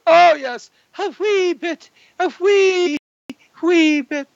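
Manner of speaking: very high squeaky-voiced aside; mock falsetto. Host joking to viewers about his younger days, in falsetto. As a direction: leap into a silly falsetto.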